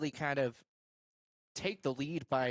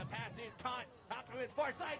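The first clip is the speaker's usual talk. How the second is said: high, rising game call building to a shout; play-by-play call; 12 semitones higher, 9 dB quieter than usual. Acted. Host calling the highlights for viewers, excited play-by-play. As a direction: call the play live as it breaks.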